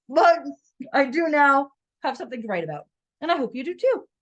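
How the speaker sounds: words round a bite of cookie; mouth full. Real, not acted. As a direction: still chewing while talking. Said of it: "talking with my mouth full".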